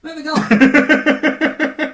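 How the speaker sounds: slow, loud, high mock-stern cutoff. Real, not acted. Host shutting down his co-host's bit, a slow loud mock-stern cutoff. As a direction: draw it out loudly to end the joke. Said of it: "cuts off the bit".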